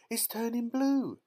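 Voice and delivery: very high, loud shriek; panicked mother's high cry; horrified scream. A narrator's reading. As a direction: scream it in alarm. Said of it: "screamed Missus Beauregard".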